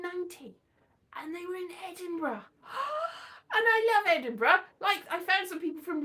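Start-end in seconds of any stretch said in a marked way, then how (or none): 2.7-3.5 high, sharp gasp; delighted gasp. Acted. Finding ancestors in Edinburgh, high amazed gasp.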